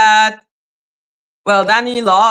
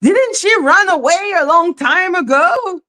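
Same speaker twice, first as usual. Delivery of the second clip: shouted disbelief; 7 semitones higher than usual. Retold or imitated. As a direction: yell it like you can't believe it. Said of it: "I was like".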